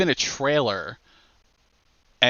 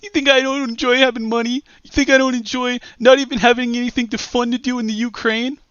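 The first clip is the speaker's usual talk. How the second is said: mocking Josh; 11 semitones higher, 4 dB louder than usual. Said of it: "painfully obvious from these chat logs".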